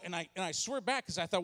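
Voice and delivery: normal talking voice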